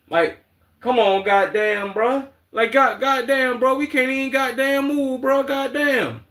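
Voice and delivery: high, stretched mock Atlanta goddamn; mocking Atlanta accent. Streamer repeating his parody of Atlanta speech, in a high mock Atlanta accent. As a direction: lampoon the Atlanta way of talking. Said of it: "They talk so motherfucking stupid".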